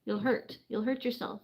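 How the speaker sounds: ordinary talk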